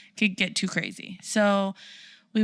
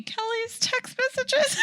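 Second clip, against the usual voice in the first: much higher, slow, laughing cry; laughing high shout; slower than usual. Retold or imitated. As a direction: squeal it out while laughing. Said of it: "her response was".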